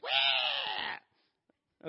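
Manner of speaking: loud, high-pitched mock scream; imitated girl's scream. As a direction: wail like a little girl on a coaster. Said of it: "this little girl starts screaming".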